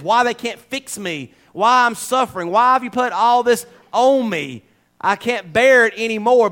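loud, rising preacher's cry; full-force preaching. Acted. Pastor urging his flock on, full-force preaching. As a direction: preach it out to the congregation.

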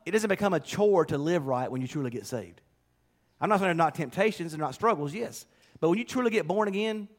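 ordinary talk, nothing marked

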